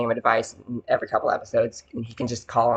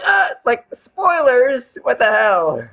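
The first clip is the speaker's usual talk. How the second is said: much higher, louder spoiler outrage; mock outraged yell; 12 semitones higher, 6 dB louder, much slower than usual. Kids yelling at family friend over spoilers, high outraged shout. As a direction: shriek it like a kid who just got spoiled.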